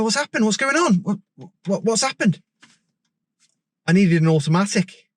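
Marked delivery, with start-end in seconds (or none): 0.0-2.4 high, urgent alarm; panicked questions to the student. Retold or imitated. Instructor to distressed student, high alarmed questions.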